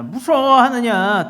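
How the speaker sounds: high, loud, drawn-out rebuking question; forceful rebuke. Acted. Preacher as Jesus challenging fearful disciples, forceful rebuke.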